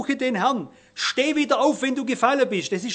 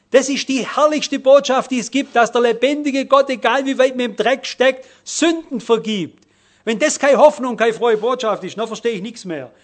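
loud, driving sermon voice; rising pulpit fervour, calling out to the congregation; fervent preaching; 2 semitones higher, 6 dB louder than usual. Acted. Preaching at full force.

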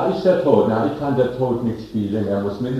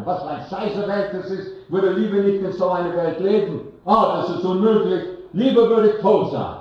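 high, rising beggar's lament; beggar's talk; 9 semitones higher than usual. Acted. Preacher voices the beggar wishing he were dead, high wailing lament. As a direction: wail it out like a fed-up beggar. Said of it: "das ist Bettlersprache".

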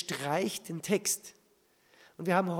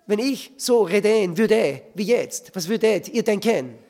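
loud, mock French final-syllable stress; falsche Betonung; 2 semitones higher, 7 dB louder than usual. Shown as a demonstration. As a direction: hit the last syllables loudly, like a Frenchman. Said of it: "Vielleicht, ich bin ein Franzose". (laut, auf den Endsilben betont wie ein Franzose)

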